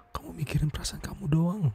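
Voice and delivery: hushed accusing whisper; bitter whisper. Acted. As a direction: whisper the reproach through hurt. Bitter reproach in a quarrel.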